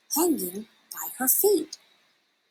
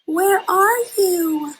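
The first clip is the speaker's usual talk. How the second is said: very high, slow, small squeak; a tiny bat pleading into the dark; squeaking baby bat; 10 semitones higher, 4 dB louder, slower than usual. Acted. Lost baby bat calls out for her mother, high slow squeak. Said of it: "Stellaluna squeaked".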